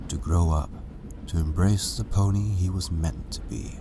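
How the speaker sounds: plain reading voice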